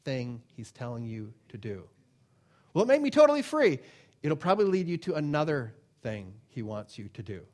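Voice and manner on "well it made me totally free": high, loud, fast joy; amazed believer. Retold or imitated. Believer marveling at being set free, high and fast. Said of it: "mock believer".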